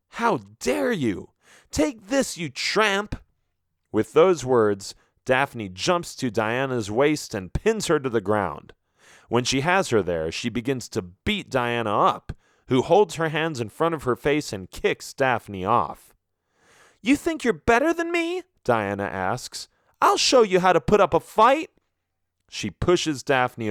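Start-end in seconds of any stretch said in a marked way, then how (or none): 0.1-3.2 high, outraged cry; outraged attack. Acted. Daphne jumping at Diana in the fight, high outraged cry. 17.0-18.4 very high, scornful challenge; furious taunt. Acted. Diana challenging Daphne mid-fight, very high scornful taunt. 20.0-21.7 high, loud, fast threat; threatening shout. Acted. Diana threatening Daphne in the mud, loud fast high threat.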